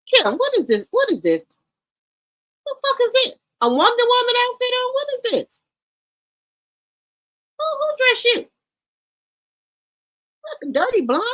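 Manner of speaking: high-pitched, loud, scandalized questioning; real aghast disbelief. Real, not acted. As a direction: pitch it right up, appalled at the outfit. Aghast at Kim Kardashian's outfit.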